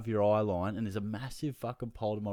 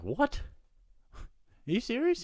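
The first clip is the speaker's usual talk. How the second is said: high-pitched, louder disbelief; retold outraged squeak of disbelief; incredulous; 3 dB louder than usual. Fan answering the security guard's swearing warning, incredulous. Retelling shocked reply to guard.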